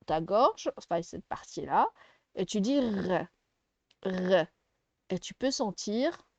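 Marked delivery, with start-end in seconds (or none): 2.8-4.5 "R" as a held, voiced throat consonant; vibrating R. Shown as a demonstration. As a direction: hold the French "R" so the throat vibrates. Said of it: "ça peut, ça vibre". (« R » tenu, qui vibre dans la gorge)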